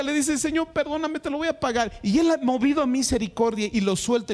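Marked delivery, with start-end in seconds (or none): 0.4-1.9 higher, begging servant voice; pleading servant. Retold or imitated. Debtor begging his master for more time, higher pleading voice. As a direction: plead for mercy, voice raised high. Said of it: "el otro le ruega".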